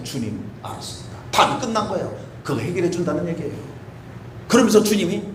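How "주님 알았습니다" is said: whispered, humbled reply; retold whisper of submission; whispered surrender. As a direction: breathe it out in surrender.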